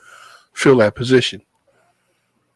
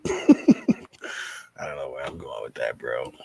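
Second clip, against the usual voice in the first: laughing; 11 semitones lower, 7 dB quieter, much slower than usual. Real, not acted. Laughs at own rambling.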